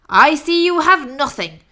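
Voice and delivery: loud, grumbling robber rat; complaining rat. Acted. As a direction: grumble it greedily. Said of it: "the rat complained".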